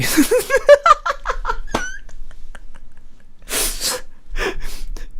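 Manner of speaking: real shrieking laughter; laughing hard at a donation message; high squealing laugh. Real, not acted. Recalling funny message.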